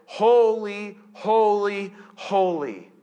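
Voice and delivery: strong, stretched-out proclamation; calling out. Acted. Preacher repeats what the seraphim say, proclaiming. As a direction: call each word out separately, settling on the last. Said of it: "They call to one another".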